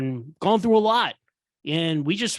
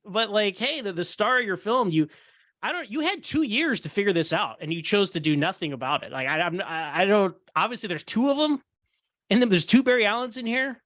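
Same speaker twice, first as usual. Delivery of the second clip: usual speaking voice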